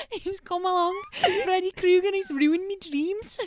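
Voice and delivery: put-on Scouse accent. Friends joking about Freddy Krueger in a Scouse accent, laughing. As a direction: crack up through a Scouse accent. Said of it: "like, in Scouse accents".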